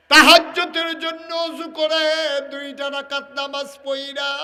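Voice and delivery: high, loud melodic exhortation; ringing sermon cry with held endings; full-force preaching. Real, not acted. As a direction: chant it out at full voice.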